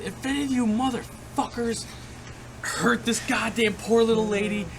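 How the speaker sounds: high, loud, furious yelling; shouted threat. Retold or imitated. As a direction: bellow the threat at the guys. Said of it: "and I said".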